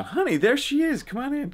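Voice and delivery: high-pitched, sing-out welcome; calling someone over. Real, not acted. Calls wife into the shot.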